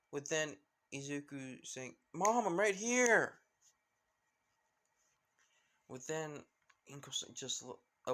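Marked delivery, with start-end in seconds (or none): 2.1-3.4 high, loud drawn-out shout; calling back. Acted. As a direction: holler back slowly so mom can find you. Son shouts back to his mother.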